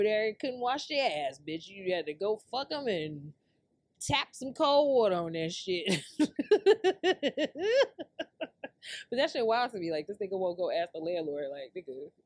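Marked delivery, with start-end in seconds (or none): 6.1-9.0 high, loud laughing fit; long cackle. Real, not acted. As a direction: crack up helplessly at your own joke. Cracks up at own insult.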